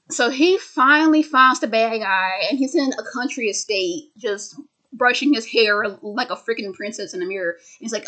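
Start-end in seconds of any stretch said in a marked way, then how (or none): none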